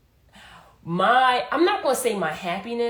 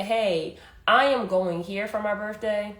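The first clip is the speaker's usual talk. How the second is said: ordinary delivery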